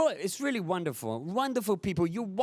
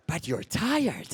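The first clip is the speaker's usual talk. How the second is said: ordinary talk, nothing marked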